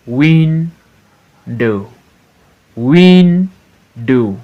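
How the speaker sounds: WIN, pause, DOW; syllable by syllable. Shown as a demonstration. Teacher showing two syllable words, Window broken as WIN-DOW. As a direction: Window split into two drawn-out beats. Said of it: "Two syllables".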